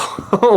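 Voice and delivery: a short, high, loud burst of laughter; a teary giggle breaking through; emotional laugh. Real, not acted. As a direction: laugh as the feeling catches you. Overcome with emotion mid-reading.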